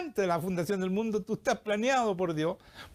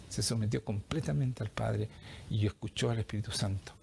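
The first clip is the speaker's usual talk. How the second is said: ordinary delivery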